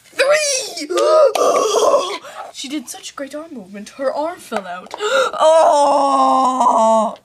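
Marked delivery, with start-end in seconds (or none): 0.0-2.2 loud high shout. Acted. Climactic count, gasp. 5.0-7.2 slow, loud I'll get you; vengeful shout. Acted. Vengeful vow after arm falls.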